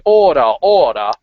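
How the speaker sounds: loud mock call to order, like a judge; gavel-banging call. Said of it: "banging his, uh, gavel and going".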